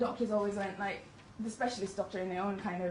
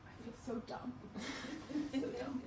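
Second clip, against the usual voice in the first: soft, muttered "I feel so dumb"; hushed, deflated inner voice; inner self-reproach; 4 semitones higher, 7 dB quieter than usual. Retold or imitated. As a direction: quietly scold yourself. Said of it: "in my head".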